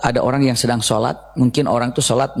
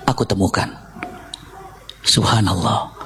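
quiet realization; 8 semitones lower, 5 dB quieter than usual. Acted. Imam realizes his sin.

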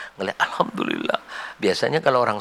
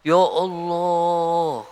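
a long, wondering sigh of ya Allah; drawn-out exclamation; 5 semitones higher, much slower than usual. Real, not acted. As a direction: stretch ya Allah out very slowly. Awe at kindness remembered. (ya Allah yang dipanjangkan pelan)

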